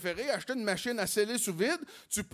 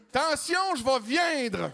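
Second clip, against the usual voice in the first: higher, strained announcement; mock climax warning; 6 semitones higher, slower than usual. Man warning his partner, higher and strained. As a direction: blurt it out with a laugh. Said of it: "J'ai dit".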